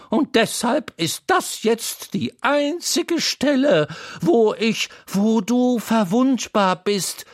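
put-on a boy stammering in wonder; young Wendel. A narrator's reading.

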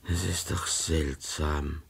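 slow, low and uneasy musing; quietly unsettled, thinking it over. Acted. A man alone after a threat, slowly and uneasily. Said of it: "sagte er sich beklommen".

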